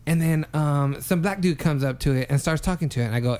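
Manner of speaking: ordinary talk, nothing marked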